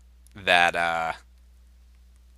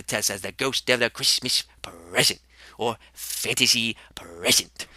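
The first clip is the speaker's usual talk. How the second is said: whisper; 2 semitones lower, 7 dB quieter than usual. Acted. The ghost whispering a quick correction to Max. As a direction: whisper the correction as a sly aside.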